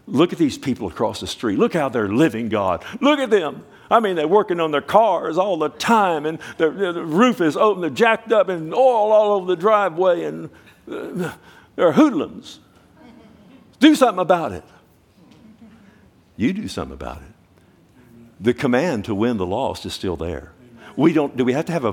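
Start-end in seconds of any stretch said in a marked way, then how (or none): 0.1-12.6 high, loud mock griping; hammed-up outraged churchgoer; indignant complaint. Acting complaint to God about neighbors. 13.8-14.6 high, loud pleading order; hammed-up exasperated insistence; indignant demand. Acting demand that God intervene. 16.4-17.3 low, measured comeback; unruffled voice from above; God's calm retort. Acted. Acting God's answer turning it back.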